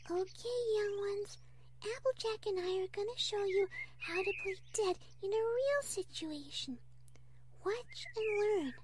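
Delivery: high, soft, gentle teacher of little ones; Fluttershy. Acted. Fluttershy introducing a demonstration to possums, high and gentle. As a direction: coax the young pupils in a small high voice.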